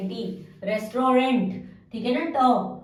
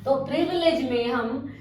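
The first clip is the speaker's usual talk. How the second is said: normal talking voice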